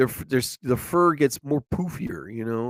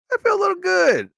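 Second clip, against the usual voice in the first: happy lift; 4 dB louder, faster than usual. Real, not acted. Happy after feeding squirrels.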